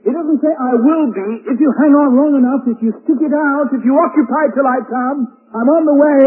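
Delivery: full-force pulpit preaching; fiery preaching. Acted. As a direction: preach it with rising fervour.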